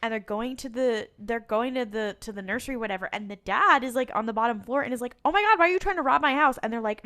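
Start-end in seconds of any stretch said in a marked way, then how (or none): 5.2-6.6 high, loud, rapid-fire alarm; mock alarm. Dad to intruders in his house, mock panicked. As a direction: blurt it out as a panicked homeowner.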